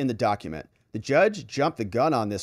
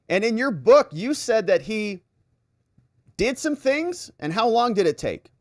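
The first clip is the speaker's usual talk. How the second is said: mock cross-examiner; 6 semitones higher than usual. Lawyer questioning Carroll about her story, insinuating. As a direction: press the witness with rising, pointed questions.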